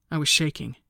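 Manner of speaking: ordinary delivery, nothing marked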